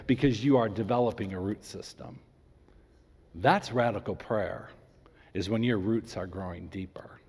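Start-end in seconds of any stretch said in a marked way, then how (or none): 5.3-7.2 hushed, breathy whisper. Real, not acted. Pastor to congregation, an intimate whisper. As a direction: lean in and whisper it. Sermon point drops to a whisper.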